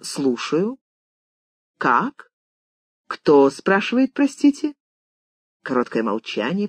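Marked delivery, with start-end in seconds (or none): 0.0-4.8 prim housekeeper answering the phone, lilting up at the end; politely stiff, questioning lift on the last word. A narrator's reading. Housekeeper answers a stranger's telephone call, primly. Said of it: "она говорит чопорно".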